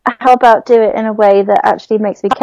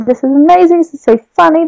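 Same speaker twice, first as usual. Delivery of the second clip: delighted thought; 8 semitones higher, faster than usual. Retold or imitated. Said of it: "chuckling away to myself".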